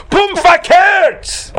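slow, high, loud declaration; very slow, loud punchline; emphatic shout. Acted. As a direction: drive it home word by word. Emphatic exclaimed conclusion.

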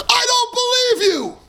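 high, shouted "I don't believe you!"; hammed-up cry of disbelief to the student; mock shout of disbelief. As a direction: blurt it out in playful outrage. Retorting to the boastful student.

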